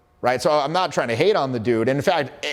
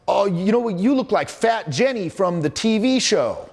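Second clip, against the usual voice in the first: mocking client; 8 semitones higher than usual. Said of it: "He says".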